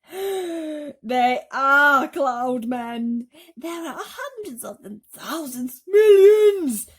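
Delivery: loud, high, very slow terror; terrified. A narrator's reading. Travellers crying out in terror at the cloud men. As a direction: horror swelling with each word.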